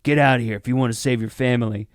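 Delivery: ordinary delivery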